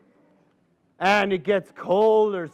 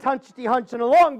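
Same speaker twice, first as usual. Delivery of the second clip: plain reading voice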